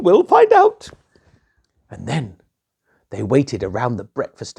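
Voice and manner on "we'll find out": very high, loud knowing queen. Acted. Queen answering the king, high and sly.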